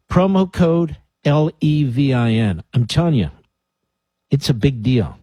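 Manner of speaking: normal talking voice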